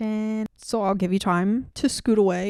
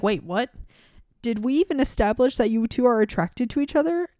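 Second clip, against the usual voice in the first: mock disbelief, rising louder; incredulous; 4 semitones higher, 5 dB louder than usual. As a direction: react like you can't believe it. Mock reaction to the book.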